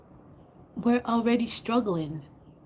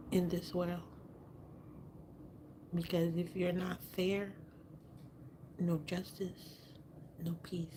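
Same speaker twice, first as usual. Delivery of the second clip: whispering; 2 semitones lower, 14 dB quieter than usual. Real, not acted. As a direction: keep it down, barely voiced. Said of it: "Why are you whispering?".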